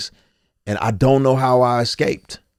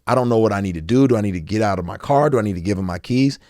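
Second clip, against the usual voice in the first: panicked driver; faster than usual. Retold or imitated. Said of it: "So she's like".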